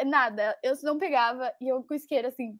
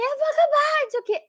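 defiant outburst; 12 semitones higher than usual. Retold or imitated. Retold outburst.

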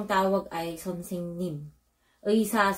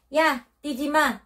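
put-on higher-pitched call to running children; mock scolding call; 7 semitones higher, 3 dB louder than usual. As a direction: call out as if stopping running kids. Voices adult calling to running children. (kunwaring pagsaway sa mga batang tumatakbo)

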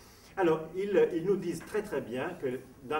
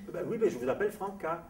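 high reply with "Franca" stressed on final "ca"; French end stress; 6 semitones higher than usual. Retold or imitated. Puzzled nurse insists to the Italian, end stress on "Franca". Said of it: "où est-ce que vous mettez l'accent".

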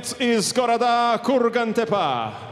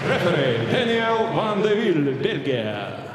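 boxing ring announcer. Acted. Ring announcer introduces referee.

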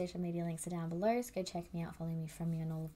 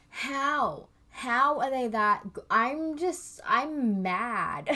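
normal talking voice

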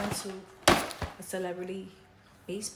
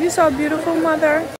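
higher, louder gushing; gushing praise; 7 semitones higher, 8 dB louder than usual. Real, not acted. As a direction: lay the admiration on thick.

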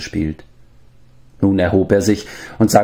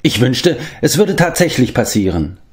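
higher voice for a wistful wish; lightly voiced, lively character longing aloud; 6 semitones higher than usual. A narrator's reading. Character wishes aloud for something fantastic, lightly wistful. Character speaks within story narration.

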